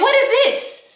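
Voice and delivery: outraged shriek of disbelief; high confronting yell. Retold or imitated. Confronting her man about the girl, high outraged shriek. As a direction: shoot up high and demand it.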